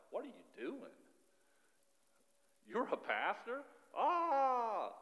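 high mocking jeer ending in a loud cry; mocking family. Family laughs at the pastor, high mocking jeer. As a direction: scoff at him, then shriek. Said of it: "everybody laughs at you and is like".

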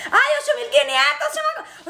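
high, delighted exclamation; real excited surprise. Real, not acted. Streamer seeing viewer count jump, high excited cry. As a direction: squeal the news to your audience.

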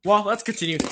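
ordinary delivery

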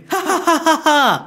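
high gleeful villain cackle; a triumphant evil laugh. Acted. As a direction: cackle at your own scheme. Villain gloats over his swap plan.

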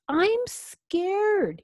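high, wailing storybook fright; scared. A narrator's reading. Vampire in the dentist's office, high and scared. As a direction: pitch it way up, frightened.

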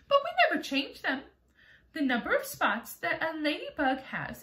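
high, proud little ladybug voice; a prim ladybug boasting sweetly, pitched high. Acted. Ladybug explains to James, high and proud.

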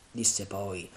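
ordinary delivery, nothing marked

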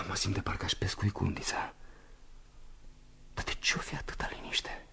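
soft eerie whisper; hushed, uneasy whisper. Acted. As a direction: whisper into the silence. Eerie aside to himself.

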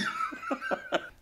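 high, breathless burst of laughter. Real, not acted. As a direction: crack up, high. Laughing at own story.

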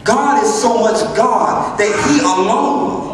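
usual speaking voice

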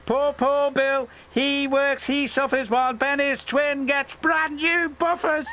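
teasing trucks. A narrator's reading. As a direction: taunt him like a gang of cheeky trucks. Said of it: "the troublesome trucks had spotted a chance for a tease".

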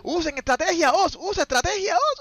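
high, exasperated game-caster plea; worked-up, high-pitched urging; exasperated pleading. Real, not acted. Caster pleads with losing player.

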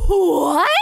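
loud, startled mock "What?"; imitating him. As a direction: echo his startled what. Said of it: "he went".

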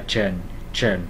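"trần" low and falling, ch plus schwa-n. Shown as a demonstration. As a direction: say "trần" as the unstressed English ending -tion after s. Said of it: "Âm ơ n mình đọc là trần". ("trần" giọng thấp, ch bật hơi rồi ơ n)

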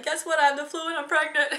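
deadpan dumping bad news, half joking; mock blunt announcement. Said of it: "that wasn't just like".

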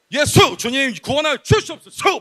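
high, imploring outburst; mimicked fervent prayer. Youth preacher imitates his friend praying and hitting him, high pleading. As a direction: imitate an overwrought plea to God.

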